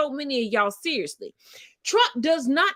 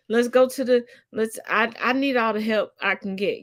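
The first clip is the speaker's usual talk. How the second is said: ordinary delivery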